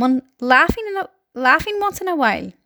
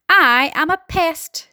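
higher, slow boast with drawn-out words; proud announcement; 6 semitones higher, 3 dB louder, slower than usual. A narrator's reading. Centipede seeking the room's approval, proudly announcing. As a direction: announce it broadly, fishing for approval. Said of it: "the centipede announced, grinning broadly".

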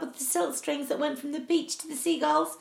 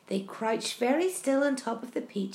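usual speaking voice

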